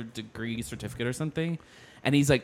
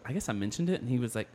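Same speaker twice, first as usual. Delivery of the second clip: usual speaking voice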